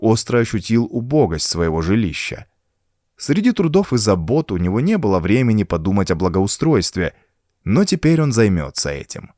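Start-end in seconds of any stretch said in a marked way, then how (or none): none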